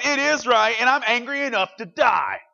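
loud, high and defiant; angry Jonah. Acted. Jonah tells God he is angry, loud and defiant. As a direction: throw the answer back at God.

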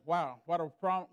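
ordinary delivery

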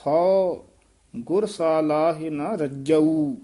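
ਰੱਜੂ said tight and firm, long final vowel; ਹਉ loud, then slow to a firm ਰੱਜੂ. Shown as a demonstration. Teacher shows how ਰੱਜੂ is said tight, firm and slow. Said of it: "ਥੋੜਾ ਜਿਹਾ ਕੱਸ ਕੇ ਉਚਾਰਨ ਕਰਨਾ ਹੈ".

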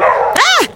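sudden high yelp of fright; startled shriek. Real, not acted. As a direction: jump and shriek at the dog. Startled by a barking dog.

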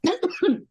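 a real dry cough. Real, not acted. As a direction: cough once, sharply. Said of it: "now I'm coughing".

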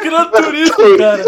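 loud, high squeal through laughter; helpless laughter. Real, not acted. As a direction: repeat the slip while dying laughing. Mocks slip, cracking up. (chorando de rir)

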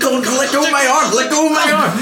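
high strained yelp. Acted. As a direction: cry out while being yanked. Being dragged by arm.

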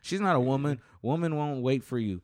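twang it like a riled hillbilly. Acted. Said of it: "Why do you say that like a hillbilly?".

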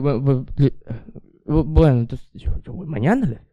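put-on halting, stumbling drunk reply; drunk stammer. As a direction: imitate a drunk fumbling for words. Acts out drunk self stammering to dad.

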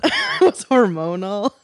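laughing admission. Real, not acted.

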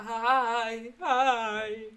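loud, strained mock "hej, hej" from the floor; hammed-up winded hello; dazed greeting. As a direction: play a fallen friend greeting from under a laundry basket. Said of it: "bara så här".

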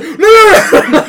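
very high, very loud squealing no; hammed-up terrified man begging from the closet; mock terrified pleading. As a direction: shriek it like a caught intruder.

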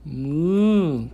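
loud playful cow moo; animal impressions. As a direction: moo for a toddler, drawn out. Parent answering the cow question.

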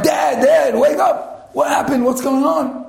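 high, urgent calling; panicked son. Retold or imitated. Son wakes fainted father.

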